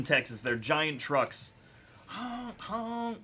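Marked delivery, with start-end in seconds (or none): 2.1-3.2 high, soft imitated honks; high horn honk. Joking about Texas trucks on the highway, high imitated honks. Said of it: "imitating truck horns".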